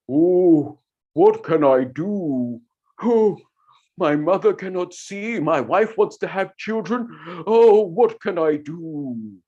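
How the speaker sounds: man worrying over mother and wife; worried man. Shown as a demonstration. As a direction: moan it like a troubled husband. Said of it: "create his voice".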